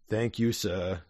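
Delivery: meek solemn servant; grave butler. Acted. Lane answers his master, low and quiet. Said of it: "Lane says gravely".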